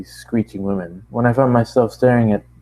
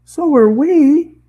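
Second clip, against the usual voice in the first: high loud protest; 8 dB louder, much slower than usual. Acted. Ponies protest.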